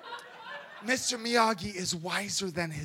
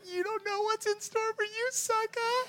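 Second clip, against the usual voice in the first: much higher, smug taunt; cocky comeback; 12 semitones higher, 5 dB quieter than usual. Retold or imitated. Believer answering the enemy, smug high taunt. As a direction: toss it off with swagger.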